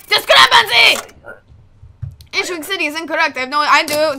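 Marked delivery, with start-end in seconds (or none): 0.1-1.2 drawn-out, high, loud "discrepancy" shout; shouted callout. Pretend. Inspector announcing an error in the papers, shouted callout. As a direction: call it out loud, stretching the word.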